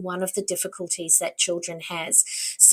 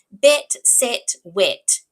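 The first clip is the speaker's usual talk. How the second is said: "Bet, set, wet" loud and separated; rhyming word list; 6 dB louder, much slower than usual. Shown as a demonstration. Teacher models rhyming sounds for a child, each word punched. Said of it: "Can you hear those rhyming sounds?".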